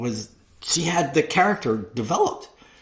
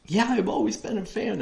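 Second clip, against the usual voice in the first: lightly voiced eager fan; 10 semitones higher, 4 dB quieter than usual. Voicing Bailey's eager reply.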